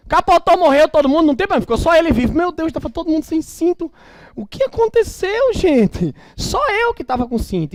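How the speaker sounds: high, loud hammed-up lament; mock dismay. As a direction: play the clueless dad in mock horror. Mocking careless father.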